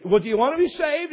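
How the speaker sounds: hammed-up high, loud question; mock-eager soul-winner pressing hard; pushy evangelist. Recruiter retold pressing the kid.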